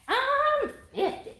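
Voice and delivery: drawn-out sketch boast; SNL "I'm fifty" bit. Acted. Host redoing the SNL age bit, high slow mock boast. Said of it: "You know that Saturday Night Live thing?".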